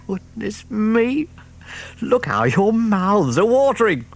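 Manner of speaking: high, relishing fox voice; delighted fox. Acted. Mister Fox choosing food, high delighted fox voice. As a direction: savour every treat aloud.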